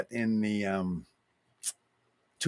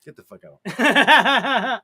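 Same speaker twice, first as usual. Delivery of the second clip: high, loud, slow line broken up by laughter; hammed-up laughing yell; laughing mock outburst; 10 semitones higher, 7 dB louder, slower than usual. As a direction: laugh your way through the curse. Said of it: "You're telling him to get out, too".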